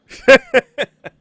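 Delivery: high cackling laugh; loud villain laugh. Acted. Evil laugh after warning.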